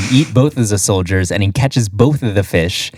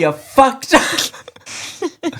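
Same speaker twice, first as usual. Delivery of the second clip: slow squealed catchphrase; much slower than usual. Pretend. Repeating catchphrase.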